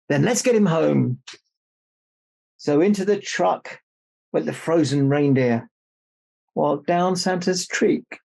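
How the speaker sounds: put-on lively read-aloud for children; story narration. A narrator's reading. Author reads her picture book to children, lively storytelling. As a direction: read the rhyme brightly to the kids.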